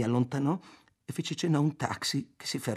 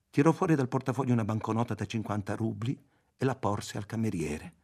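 ordinary delivery

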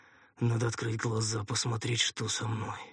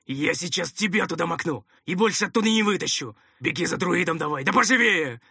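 loud, raised threatening bellow; rough commander shouting, building in force; angry yelling; 8 semitones higher, 8 dB louder than usual. A narrator's reading. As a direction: roar orders at a useless underling. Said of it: "заорал второй голос".

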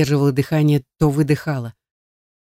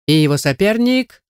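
higher, hyped-up fight announcer; ring announcer; 5 semitones higher than usual. A narrator's reading. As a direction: announce the challenger. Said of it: "вновь раздался голос конферансье".

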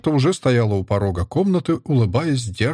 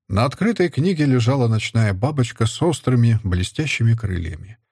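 ordinary delivery

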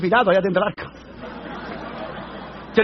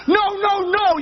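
high, frantic refusal; acted panic; 9 semitones higher, slower than usual. Passenger kneeling by his seat, mock-panicked refusal. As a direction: protest in a pitched-up voice.

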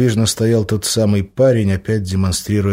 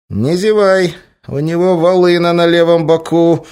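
higher, louder anxious warning; alarmed; 9 semitones higher, 6 dB louder than usual. A narrator's reading. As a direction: warn him urgently. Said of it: "тревожно сообщил кот".

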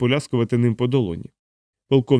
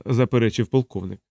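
ordinary delivery

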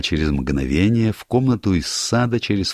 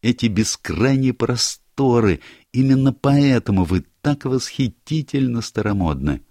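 higher, brighter-voiced girl, teasing; lively young woman; 8 semitones higher, 4 dB louder than usual. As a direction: play Barbara breezing into the room, higher and louder. Barbara voiced by narrator.

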